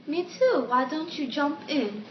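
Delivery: bad British accent, pitched high; [name]. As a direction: put on a terrible British accent. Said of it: "my British accent is so bad".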